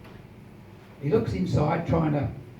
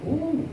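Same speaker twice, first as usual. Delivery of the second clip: high drawn-out sailors' ooh; a crowd's hushed wonder; impressed ooh; 11 semitones higher than usual. Acted. Sailors react to free rum with a high ooh.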